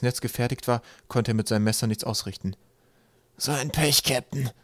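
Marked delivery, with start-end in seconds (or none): 3.4-4.5 high, pitiful little complaint; plaintive. A narrator's reading. Trapp hangs squashed in the trap, plaintive. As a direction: lament it miserably to your captain. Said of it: "sagte Trapp Hammelhört kläglich".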